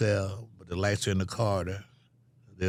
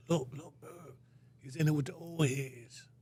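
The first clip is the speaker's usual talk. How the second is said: peers whispering; 6 semitones higher, 7 dB quieter than usual. Retold or imitated. Peers nudging each other, hushed.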